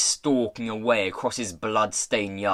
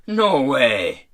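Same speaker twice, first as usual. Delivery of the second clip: louder indignant retort; defiant refusal; 6 dB louder than usual. A narrator's reading. Pirate refusing to give back the coat, defiant. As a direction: refuse it firmly, loudly.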